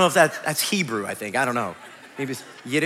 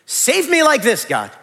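demanding prayer; 6 semitones higher, 5 dB louder than usual. Acted.

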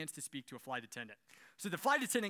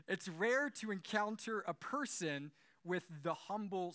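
ordinary talk

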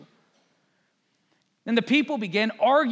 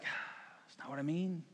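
soft, slow mock exasperation; acted long-suffering murmur; weary correction; 4 semitones lower, 13 dB quieter than usual. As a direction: sigh it out quietly, drawn out. Jesus exasperated at being misread, acted.

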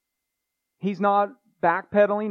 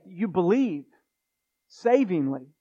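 normal talking voice

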